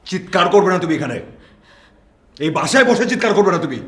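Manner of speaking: angry scolding. Acted. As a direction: shout the other person down. Partner snaps back during quarrel.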